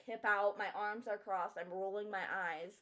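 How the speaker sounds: usual speaking voice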